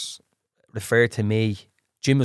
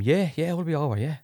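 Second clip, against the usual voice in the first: higher, softer, casual yeah-yeah; retold offhand agreement; 6 semitones higher, 3 dB quieter than usual. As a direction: brush it off lightly, pitch lifted.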